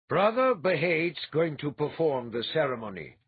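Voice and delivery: firm announcement that settles the matter; ending the discussion. A narrator's reading. Althalas settling the wedding dispute, firm and final. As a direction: shut the argument down as you say it. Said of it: "in a tone that ended the discussion".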